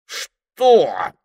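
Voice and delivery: high, loud indignant outburst. A narrator's reading. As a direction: squawk it in outrage. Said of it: "от возмущения даже привстал".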